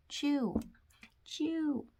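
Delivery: high, sing-song mother calling chew chew; mom train voice. Acted. Mom train's reply in joke.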